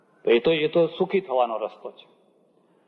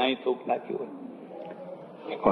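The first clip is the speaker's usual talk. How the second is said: disgusted whisper; 3 semitones lower, 8 dB quieter, slower than usual. Acted. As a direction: whisper 'અહીં થૂંક નાખ્યું હોય' slowly, voice dropped away. Repeats spitting complaint, whispered. (ધીમે, ગુસપુસ અવાજે અણગમો)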